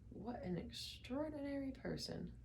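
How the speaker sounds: an amazed aside kept to himself; muttered to himself. A narrator's reading. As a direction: say it slowly to yourself, barely aloud. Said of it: "he muttered to himself".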